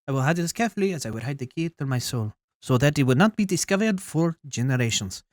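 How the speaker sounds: put-on overdone French accent, emphatic reading; heavy French accent. Queen hiding a diamond for generations, heavy French accent. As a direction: lay the French accent on thick. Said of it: "is the accent too much?".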